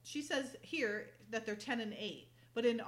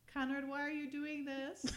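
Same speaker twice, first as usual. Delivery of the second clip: mock pleading; 6 semitones higher than usual. Acts out pleading woman, laughing.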